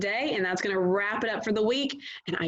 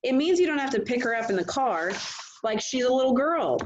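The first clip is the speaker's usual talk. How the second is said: stern; 6 semitones higher than usual. A narrator's reading. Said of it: "he said sternly".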